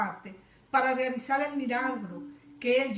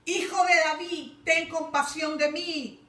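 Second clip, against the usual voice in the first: high, loud, slowed plea; blind man crying out; 6 semitones higher, 5 dB louder than usual. Retold or imitated. Beggar Bartimeo crying out to Jesus. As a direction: shout for mercy across the road. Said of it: "Comenzó a gritarle".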